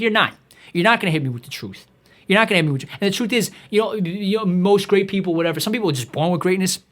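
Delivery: ordinary talk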